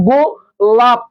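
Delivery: গোলাপ said slowly, the final প closing the lips; slow closed-syllable demo. Shown as a demonstration. Teacher to students, says গোলাপ slowly. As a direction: draw out গোলাপ and stop hard on the lips. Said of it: "মুখ বন্ধ হয়ে যাচ্ছে ভাই".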